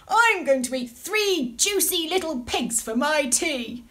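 loud gleeful wolf; greedy wolf. Acted. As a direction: gloat like a hungry wolf. Wolf's greedy thought.

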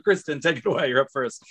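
ordinary delivery

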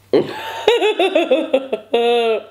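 high-pitched, drawn-out giggling fit; long high laugh. Real, not acted. Eater who is too full to go on, long high laugh. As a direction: laugh high in helpless bursts.

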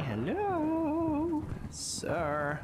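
very high, drawn-out hello; playful high-pitched greeting; mock greeting. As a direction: coo it in a falsetto.

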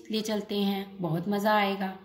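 usual speaking voice

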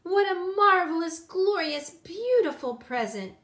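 high, loud, gushing Granny; delighted cry. A narrator's reading. Granny hugging her girl, loud delighted cry. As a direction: exclaim it, each adjective rung out. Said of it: "cried Granny".

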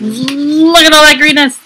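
high, loud exclamation of delight; excited outburst. Real, not acted. Excited gamer admiring the scenery.

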